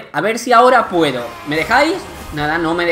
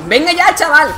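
exasperated outburst; 9 semitones higher, 5 dB louder than usual. Real, not acted. Frustrated at the game.

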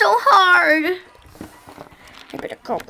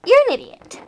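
sharp scold; 8 semitones higher, much faster than usual. Acted. Says the same insult twice.

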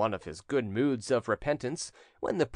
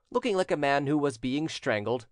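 usual speaking voice

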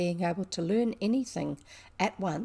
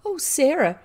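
higher, louder delighted greeting; crying out; 8 semitones higher, 7 dB louder than usual. A narrator's reading. Girl greeting the friend who has come, crying out. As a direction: cry out the name in relief. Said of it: "she cried out".

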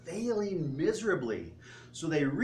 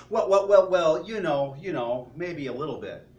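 loud, stammering mock dodge; a caught-out fumble that fades down; flustered stammer; 8 dB louder than usual. Owner caught lying to the chef, loud flustered stammer.

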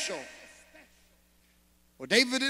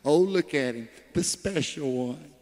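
sneering mock of the special one; jealous, scoffing sarcasm; mocking the favorite; 7 semitones lower than usual. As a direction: roll your eyes at the golden boy. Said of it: "Everybody's like".

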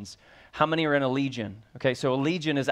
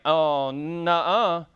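slow, drawn-out "Oh, nuh-uh" of a stubborn objector; defiant refusal; 3 semitones higher, 4 dB louder, much slower than usual. Retold or imitated. A preacher mocks his congregation's instinct, slow defiant nuh-uh. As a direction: stretch it out like you won't back down. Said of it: "We would be the one going like".

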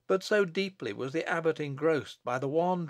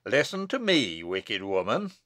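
stern abbot; 5 semitones lower than usual. Acted. Abbot addresses the wicked woman, stern command. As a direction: order her with grave authority.

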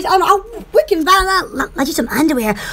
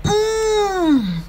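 ordinary talk, nothing marked

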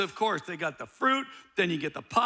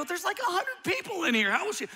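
incredulous protest; 13 semitones higher than usual. Retold or imitated. Disbelieving husband protests.

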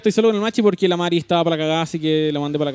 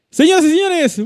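booming showman call, much higher; ringmaster; 13 semitones higher, 7 dB louder than usual. Pretend. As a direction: belt it out like a circus host. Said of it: "Me gustó ese ¡ah!".

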